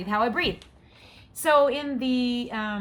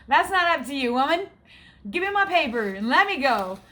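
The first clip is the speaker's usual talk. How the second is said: louder, higher, with a laugh, telling her off; indignant retort; 5 semitones higher, 5 dB louder than usual. Retold or imitated. To the test technician, a laughing, fed-up telling-off. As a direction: push back at the technician, half laughing. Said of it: "And I'm like".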